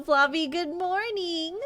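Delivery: ordinary talk, nothing marked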